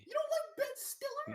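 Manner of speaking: very high, drawn-out squeal of disbelief; mock disbelief. Host reacts to friend disliking a comedian, mock shock. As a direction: squeak it slowly, hammed-up shock.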